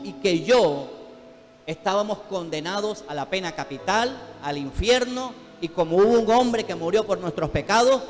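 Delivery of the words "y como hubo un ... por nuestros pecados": loud, driving sermon climax; preaching at full force. Acted. Preacher pressing the congregation toward service, full force. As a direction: preach it louder with each phrase.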